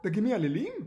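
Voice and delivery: loud, high rising question; amazed fisherman; astonished. Acted. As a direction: ask in loud disbelief, pitch climbing. Fishermen astonished by meowing fish.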